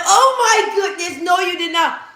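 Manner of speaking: high, mock-shocked mom; mom's voice. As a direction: squeal it like a scandalized mom. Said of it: "My mom be like".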